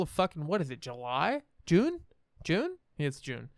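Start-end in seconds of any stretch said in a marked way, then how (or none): 1.6-2.0 high squeaked question; surprised question. Real, not acted. Unsure of the month. 2.4-2.8 high squeaked question again; double-check it in disbelief; surprised question. Real, not acted.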